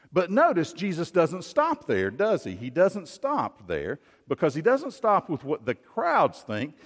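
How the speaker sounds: ordinary talk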